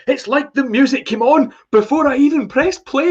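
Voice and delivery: imitating the runner. Quoting the running rock reviewer to Kev, high and excited. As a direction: squeal it like the guy raving mid-run. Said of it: "He's like".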